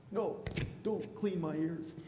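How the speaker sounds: put-on a touch of whiny kid pushing away; mildly squirm away like a child; childish protest.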